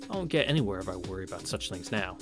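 normal talking voice

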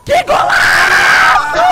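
slow, loud stretched goal shout; explosive goal commentary. Real, not acted. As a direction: roar it like a goal call. Scoring a goal.

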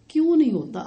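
loud, high rhetorical why not; scolding listeners who skip breakfast, loud and high; indignant challenge. Acted. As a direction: throw the excuse back at them. Challenging excuse about skipping breakfast.

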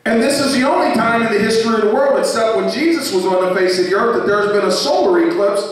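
high-pitched, driving sermon delivery; building preaching. Real, not acted. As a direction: preach it with rising urgency. Preacher building at full force.

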